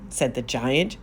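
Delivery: ordinary delivery, nothing marked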